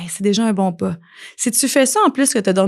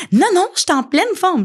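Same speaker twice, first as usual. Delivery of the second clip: much higher, stubborn insistence; insistent denial; 10 semitones higher than usual. Pretend. Sick person insisting she's fine, higher insistent denial. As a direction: protest too much that you're fine. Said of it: "Ça, c'est du déni".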